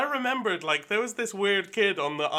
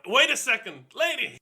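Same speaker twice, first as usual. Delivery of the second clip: high, raised call to a stranger; indignant holler, rising higher; 7 semitones higher, 3 dB louder than usual. Acted. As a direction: stop her with an urgent shout. Calls after woman taking present.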